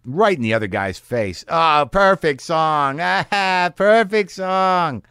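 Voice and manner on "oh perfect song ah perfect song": high, loud and slow, laughing through it; laughing taunt. Retold or imitated. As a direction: gloat at him while cackling. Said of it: "one guy starts laughing at".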